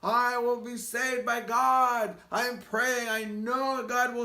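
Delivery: high, loud, slow refusal; shouting back. Acted. Man on roof shouts back to rescuer, loud stubborn refusal. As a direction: yell up over the rotor noise. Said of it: "shouted back to him".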